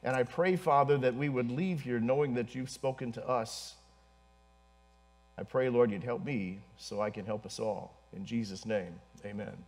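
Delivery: low prayer, softening to a quiet amen. Real, not acted. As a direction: bring the prayer gently to a close. Closes the prayer.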